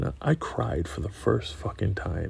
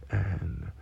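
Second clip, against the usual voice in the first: ordinary talk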